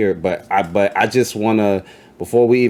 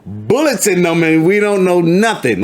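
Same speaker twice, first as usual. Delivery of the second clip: laughing; 11 semitones higher, 4 dB louder than usual. Real, not acted. Laughing over shocking revelation.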